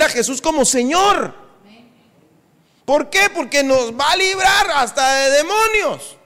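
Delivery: high, ringing preacher's call; full-force preaching. Acted. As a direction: lift the congregation, preaching high and strong. (predicación a todo pulmón)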